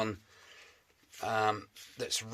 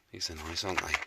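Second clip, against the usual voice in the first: usual speaking voice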